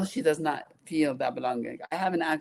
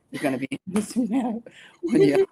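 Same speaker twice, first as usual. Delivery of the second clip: giggling through a higher, quick retold taunt; laughing while quoting; 8 semitones higher, 4 dB louder, faster than usual. Recalling what people told her, laughing through the quote. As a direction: laugh through the quoted words as you tell them.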